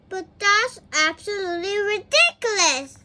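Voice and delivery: Little Miss Giggles objecting; indignant. A narrator's reading. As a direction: scoff at the idea. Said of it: "said Little Miss Giggles".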